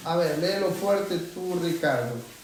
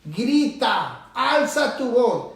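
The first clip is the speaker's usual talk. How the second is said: slow, rising exhortation; shouted preaching; 3 semitones higher, much slower than usual. Shown as a demonstration. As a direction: lift the voice and draw out each command. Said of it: "Está gritando".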